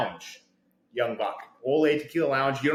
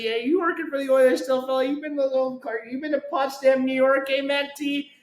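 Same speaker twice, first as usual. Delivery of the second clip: eager chatter; 11 semitones higher than usual. Retold or imitated. Pestering Mac T excitedly.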